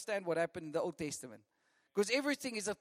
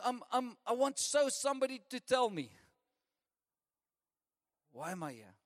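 desperate plea; 4 semitones higher than usual. Retold or imitated. Lost people crying out for meaning.

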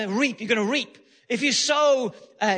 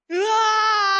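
loud, high, long roaring yell; sudden roar of an attacking lion; lion's roar; 10 semitones higher, 5 dB louder than usual. Acted. Lion attacks at the open gate, sudden roar. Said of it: "the lion roars".

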